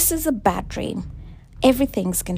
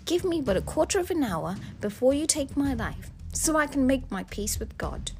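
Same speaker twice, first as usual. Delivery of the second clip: soft, high-pitched begging; hushed, imploring wife; pleading; 5 semitones higher, 5 dB quieter than usual. A narrator's reading. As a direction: beg for your life, gently and desperately. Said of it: "She pleaded for her life".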